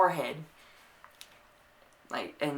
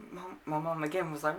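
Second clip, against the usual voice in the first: ordinary delivery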